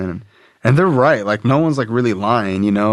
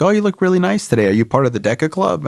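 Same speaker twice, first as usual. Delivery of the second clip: higher and fast, bubbly compliment; chirpy teacher; 7 semitones higher, faster than usual. Retold or imitated. Teacher complimenting his outfit, high, fast and chirpy. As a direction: gush like an overly sweet teacher. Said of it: "teachers come up to me like".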